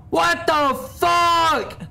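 high, slow, disbelieving outburst; shocked drawn-out cry. Real, not acted. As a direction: let the shock stretch the words out slow. Shocked by a sudden scare.

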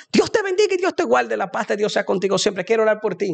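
high, sustained opening blessing, easing down slightly; high-pitched catchphrase. Acted. Reenacts his viral video line.

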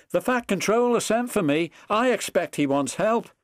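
high, puffed-up little boast; self-important little engine, pitched high. A narrator's reading. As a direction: announce your news importantly. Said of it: "he said importantly to Edward".

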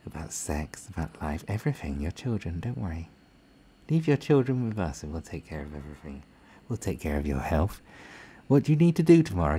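a hammed-up caring bureaucrat; patronising official. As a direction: smother them with fake care. Mocking patronising government voice.

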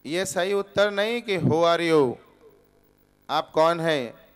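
ordinary delivery

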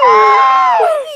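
high loud shocked oh; shocked squeal. Real, not acted. Reaction to Mummy story.

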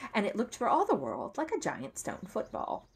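usual speaking voice

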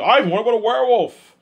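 high, loud mock director pitching an idea; Guillermo del Toro. Retelling Guillermo del Toro's suggestion, high and loud. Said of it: "Guillermo del Toro's like".

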